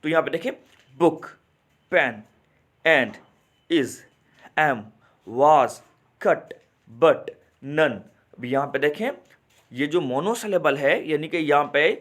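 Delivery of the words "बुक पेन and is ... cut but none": each word from बुक to none in one short beat, pause after each; spaced list, every word an unbroken single sound, बुक to none; one-syllable words. Shown as a demonstration. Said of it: "तकसीम नहीं हो रहा मेरा sound". (बुक से none तक हर शब्द एक झटके में, रुक-रुककर)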